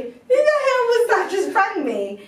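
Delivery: high, loud baffled voice; imitating a freaked-out co-worker; confused caller. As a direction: sound spooked and bewildered. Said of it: "looking at the phone thinking".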